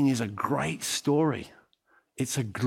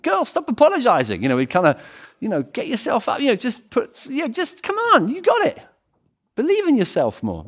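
much higher, brassy cheerleading; pep-talk friend; 12 semitones higher, 3 dB louder than usual. Retold or imitated. Friends urging humble Mary to believe in herself, high and brassy. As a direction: hype her up like a pushy friend. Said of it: "we'd be like".

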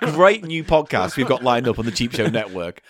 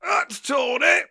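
mock wail; 10 semitones higher, slower than usual. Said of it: "I want to hear a torn rectum".

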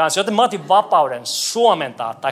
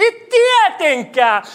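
emphatic denial; 13 semitones higher, 6 dB louder, slower than usual. Acted.

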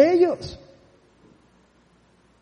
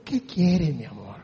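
very low and soft, loving; hushed loving father voice; tender reply; 13 semitones lower, 12 dB quieter than usual. Retold or imitated. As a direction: answer like a patient father. God answering gently.

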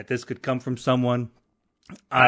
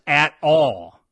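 "at all" said louder as capitals; all caps emphasis; 2 semitones higher, 5 dB louder than usual. Shown as a demonstration. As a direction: push "at all" out like it's shouted in caps. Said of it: "all caps".

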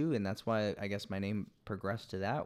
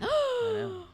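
squeal; 3 dB louder than usual. Real, not acted. Guest admits never doing cards.

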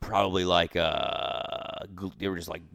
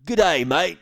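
high, loud mock Australian greeting; Australian accent; 9 semitones higher, 8 dB louder than usual. A buddy jokingly greets his cohost in a loud Australian accent. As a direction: ham up an Aussie accent, pitched high. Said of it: "that's Australian shit".

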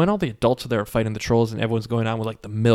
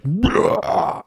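higher, louder villain bellow; a hammed-up power cry; mock roar; 8 semitones higher, 3 dB louder than usual. Demon troll raising his arms to summon fire, mock roar. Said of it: "raises his arms and goes like".

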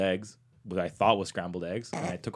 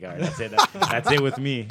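high, laughing mock huff; hammed-up brush-off through laughter; mock huffy dismissal; 5 semitones higher, 7 dB louder than usual. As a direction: imitate someone giving up in a huff. Retold stranger gives up on him.

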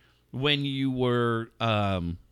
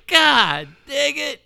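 mock exasperation; 13 semitones higher, 6 dB louder than usual. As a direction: scold him while cracking up. Acted exasperation, laughing.

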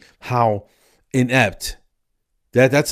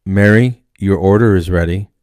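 much louder public announcement; order call on mic; 10 dB louder than usual. Retold or imitated. Counter lady calls a waiting customer, loud mic announcement. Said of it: "she gets on the mic".

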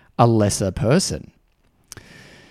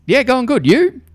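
much higher, quick and cheery; chirpy reply; 13 semitones higher, 3 dB louder than usual. Retold or imitated. Other person answering in the corridor, high chirpy reply. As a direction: bounce the reply back brightly.

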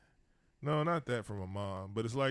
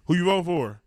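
imitated pool-hall dude; 6 semitones higher, 7 dB louder than usual. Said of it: "one of the Mexican dude asked".

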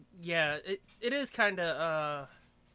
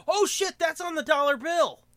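high, loud excited outburst; 11 semitones higher, 9 dB louder than usual. Real, not acted. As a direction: blurt it out in excitement. Excited at screen.

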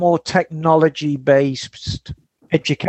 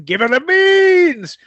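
mock Scots; 10 semitones higher than usual. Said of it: "mock Scots sign-off".

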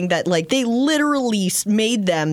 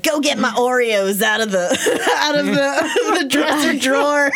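laughing; 5 semitones higher than usual. Retold or imitated. Great aunt voiced while laughing.

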